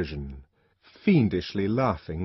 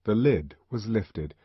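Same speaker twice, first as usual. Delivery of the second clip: plain reading voice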